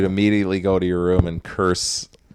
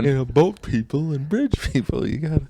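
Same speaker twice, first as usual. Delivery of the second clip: Isaac Whitty bit; 7 semitones higher than usual. Retold or imitated. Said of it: "He's like".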